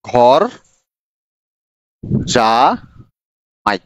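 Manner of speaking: ঘর, long pause, then জামাই stretched out slowly; teacher dictating a word very slowly; drawn-out dictation. Shown as a demonstration. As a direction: spell out ঘর জামাই slowly for the class to write. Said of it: "ওই যে ঘর জামাই শব্দটায়".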